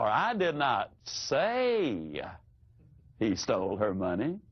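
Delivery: "say" stretched long, then a pause and a low finish; stress on SAY. Shown as a demonstration. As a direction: draw out "say", pause, drop the rest low. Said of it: "Completely different".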